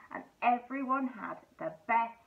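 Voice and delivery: plain reading voice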